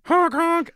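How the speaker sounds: high, loud honking like a clown horn; silly clown horn honk. Pretend. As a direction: squeeze out a goofy clown honk. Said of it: "I put a clown horn on the doorknob".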